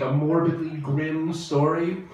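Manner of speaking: normal talking voice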